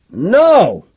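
high, loud cry of refusal; shout. A narrator's reading. Narrator refuses to let Carnes go, shouting. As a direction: shout it, pitch leaping. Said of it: "I shout".

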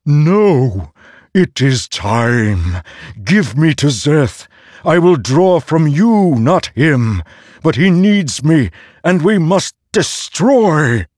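high, loud, slow demanding blade; the sword insists, refusing to be sheathed; forceful sword voice. A narrator's reading. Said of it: "the tone possessing an uncharacteristic forcefulness".

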